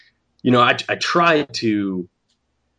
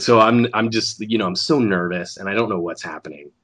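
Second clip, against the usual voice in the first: ordinary delivery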